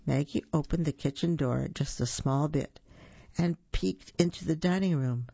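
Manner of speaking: plain reading voice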